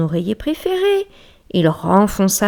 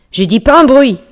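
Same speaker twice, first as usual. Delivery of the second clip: higher, louder hammed-up bear scolding; bear's loud rebuke; 7 semitones higher, 11 dB louder than usual. Bear sitting up to scold the mouse, loud and higher. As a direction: bark it at the mouse. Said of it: "L'ours se redressa".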